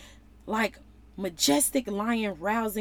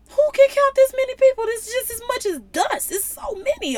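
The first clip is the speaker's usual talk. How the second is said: awed exclaim; 13 semitones higher, 5 dB louder, faster than usual. Acted. Preacher voicing awe at crowds.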